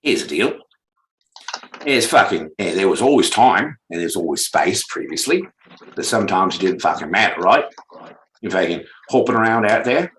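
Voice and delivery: hammed-up Australian accent; Aussie accent. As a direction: ramble along in a broad mock Aussie accent. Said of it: "in an Aussie accent".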